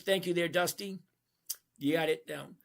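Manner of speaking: ordinary talk, nothing marked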